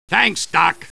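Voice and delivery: usual speaking voice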